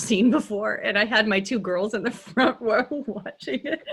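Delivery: words breaking into giggles; laughing. Real, not acted. Coach recalling daughters watching a raunchy show, laughing. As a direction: let the laugh creep in as you tell it.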